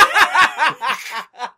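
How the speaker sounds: loud, high-pitched real laugh; helpless high burst of laughter. Real, not acted. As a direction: crack up loudly mid-story. Cracks up at own acted line.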